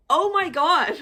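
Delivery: high, laughing "oh my God"; acted-out exasperation with a laugh; exasperated exclamation. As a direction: wail it in mock disbelief. Reacting to doorbell in her story.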